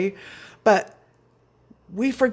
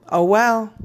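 dismissive shrug; 2 semitones higher, 5 dB louder than usual. Real, not acted. Shrugging off ultimatum charge.

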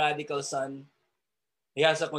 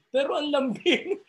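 higher, sweet Ilonggo melody; Ilonggo lilt; 10 semitones higher than usual. Retold or imitated. Preacher mimicking gentle Ilonggo tone. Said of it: "Ang sarap gayahin ng kanilang tonality".

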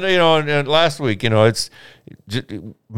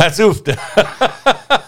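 laughing; 8 semitones higher, much slower than usual. Retold or imitated. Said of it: "I said".

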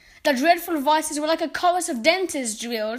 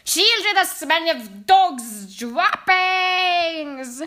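slow, louder, higher witch screech; 4 semitones higher, 5 dB louder, much slower than usual. Acted. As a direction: drag each word out like a furious old witch. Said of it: "screeched the Grand High Witch".

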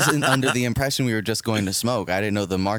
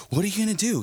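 high-pitched mimicked challenge; mock confrontational; 11 semitones higher, faster than usual. Man squaring up to his partner, mock confrontational. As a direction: imitate a man peacocking in a spat.

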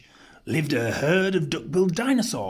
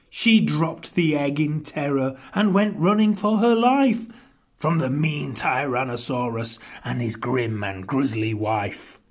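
ordinary delivery

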